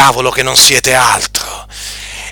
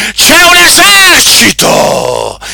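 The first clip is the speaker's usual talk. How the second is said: high, slow shout on un; pulpit shout; 12 semitones higher, slower than usual. Acted. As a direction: cry it out as an alarm. Preacher raising the alarm.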